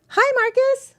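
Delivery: squeaky high greeting to the cat; high pet voice. Real, not acted.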